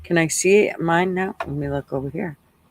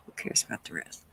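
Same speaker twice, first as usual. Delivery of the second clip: a dismissive aside only chat should hear; softly whispered brush-off; 10 semitones lower, 15 dB quieter, faster than usual. Real, not acted. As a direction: whisper it under the breath, much softer.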